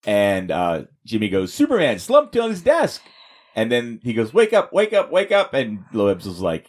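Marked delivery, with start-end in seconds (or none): 1.5-3.0 high, louder startled squeal; high alarmed voice. Retold or imitated. 4.3-5.5 high, louder pleading wake up; urgent high call. Retold or imitated. Jimmy shaking Superman awake.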